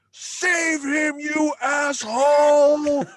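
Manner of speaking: high, loud, slow yell; imitating a biker yelling at the screen. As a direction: shout at the screen like they can hear you.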